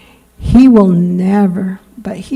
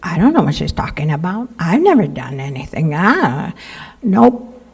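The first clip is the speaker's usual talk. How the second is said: mock denial; 4 semitones lower, 5 dB quieter than usual. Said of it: "look at another person and say".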